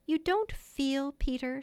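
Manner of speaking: slow, halting, hesitant ask; faltering. A narrator's reading. Wendy falters, asking Peter about a sweet subject. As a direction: falter over the shy question. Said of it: "she said falteringly".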